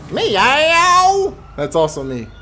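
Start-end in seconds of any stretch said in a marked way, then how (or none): 0.1-1.4 high, loud-ish put-on meow; cat meow. Shown as a demonstration. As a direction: imitate a cat, pitched well up. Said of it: "What about the cat?".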